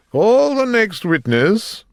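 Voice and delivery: high, loud, drawn-out court summons; calling out. A narrator's reading. King summoning a witness in court, calling out grandly. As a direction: call it across the courtroom, slowly.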